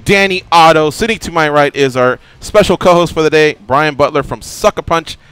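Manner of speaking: high, loud show opener; radio host intro. Acted. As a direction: pump up the show open.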